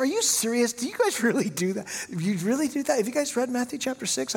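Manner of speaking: high-pitched, mock-astonished questions; retelling a disbelieving challenge; incredulous questioning. Pastor challenging friend over plaque ceremony, incredulous questioning.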